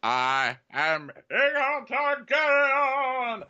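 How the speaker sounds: very high, drawn-out mock-epic declaration; grandiose proclamation. Jon revealing his true name, hammed-up and grandiose. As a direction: stretch the name out theatrically.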